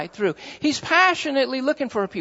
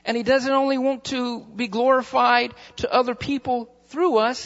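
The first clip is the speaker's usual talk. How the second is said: usual speaking voice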